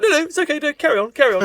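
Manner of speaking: high, loud breezy posh English brush-off; posh English bloke. Retold or imitated. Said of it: "some, like, posh English bloke".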